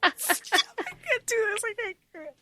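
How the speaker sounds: real emotional overload, fading to nothing; overwhelmed fangirl wail. Real, not acted. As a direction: wail as if it is too much.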